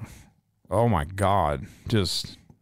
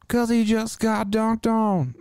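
teasing; 13 semitones higher, slower than usual. Acted. Teasing James crying.

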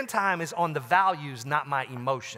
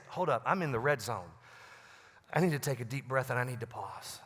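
calm self-check; 5 semitones lower, 6 dB quieter, faster than usual. Shown as a demonstration. Models calming oneself in red zone.

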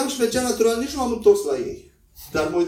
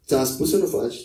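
calm parent; 6 semitones lower than usual. Shown as a demonstration. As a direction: say it calmly and steady. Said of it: "rămâi calm".

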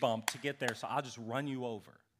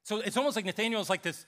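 ordinary delivery

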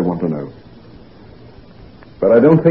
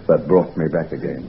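lower, softer, moved; tender hush; 6 semitones lower, 7 dB quieter than usual. Acted. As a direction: let the memory soften the words. Recalling her hand saving him.

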